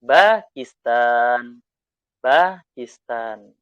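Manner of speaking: "Pakistan" drawn out very slowly and loudly; teacher drills "Pakistan" syllable by syllable; slow model word. Shown as a demonstration. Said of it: "kita lihat ya".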